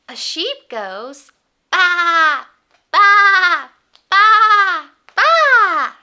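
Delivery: loud, high bleating baa; sheep baa. Pretend. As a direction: bleat like a little lamb. Sheep sound for toddlers.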